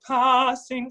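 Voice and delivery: passing with a vowel between ah and a; Mid-Atlantic passing. Shown as a demonstration. Coach models the target vowel for the singer: Mid-Atlantic passing. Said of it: "the child of the two".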